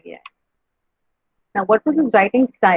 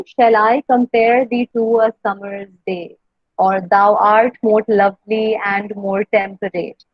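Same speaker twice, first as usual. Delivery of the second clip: slow, measured beat on "Shall I compare thee"; iambic stress; much slower than usual. Shown as a demonstration. Teacher reads one more sonnet example, slow iambic stress on "Shall I compare thee". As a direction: stress every second syllable: I, -pare, to, sum-, day. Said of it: "he wrote in iambic pentameter".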